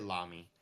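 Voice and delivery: Lamy rhyming with mommy; American pronunciation. Shown as a demonstration. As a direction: say Lamy as LAH-mee. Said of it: "But we say Lamy".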